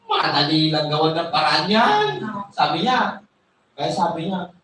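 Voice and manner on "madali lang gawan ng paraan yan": lower, knowing mother's voice; scheming mother. Retold or imitated. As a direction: drop the voice low and sly. Rebecca reassures Jacob.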